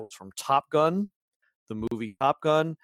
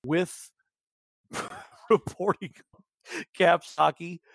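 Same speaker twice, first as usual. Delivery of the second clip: trying to talk through real giggles; laughing; 2 semitones higher than usual. Real, not acted. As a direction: let laughter break up the words. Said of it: "I don't know why I'm laughing".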